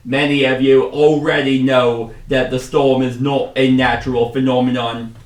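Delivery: put-on loud commander in a muddled accent; wobbly Australian-British accent, projected firmly; unsure put-on accent. Commander briefing hunters on the storm, loud muddled accent. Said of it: "I don't know what accent I'm doing".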